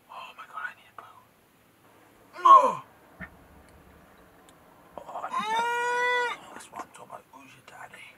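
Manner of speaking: hushed whisper. Real, not acted. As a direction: whisper under the breath. Whispering in the dark.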